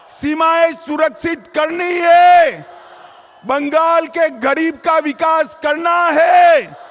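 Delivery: shouted rally slogans; booming political rally speech. Real, not acted. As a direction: rouse the crowd, loud.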